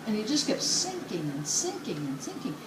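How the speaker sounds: ordinary talk, nothing marked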